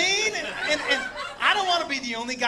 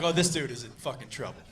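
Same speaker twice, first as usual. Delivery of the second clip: low, softer aside to oneself; hushed concern; 11 semitones lower, 6 dB quieter than usual. Retold or imitated. Onlooker seeing man not moving, hushed concern. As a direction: drop low, as if privately worried. Retold worry over motionless man.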